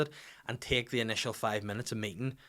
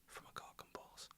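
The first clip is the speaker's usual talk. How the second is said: whisper; 26 dB quieter than usual. Retold or imitated. Quoting the guy's cheeky reply.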